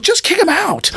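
very high-pitched mock exasperation; exasperated sensei. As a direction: imitate an impatient boss squawking an order.

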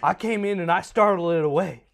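hammed-up Southern insistence; Southern accent. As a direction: brag it as a comic Southern dad. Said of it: "he's becoming more southern".

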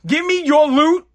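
ordinary talk

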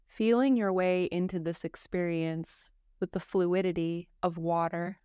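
calm, even yoga nidra guidance with long pauses; guided meditation. Acted. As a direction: guide a listener gently into rest, phrase by phrase.

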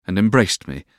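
plain reading voice

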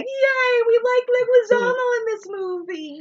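high, squealing mock cheer. Host cheering Leguizamo punching Yosef, squealing mock cheer. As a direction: squeal it like a delighted kid. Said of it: "which was what made me be like".

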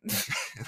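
high squeaky tape-rewind noise. Pretend. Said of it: "That's my rewind noises".

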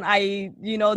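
ordinary talk

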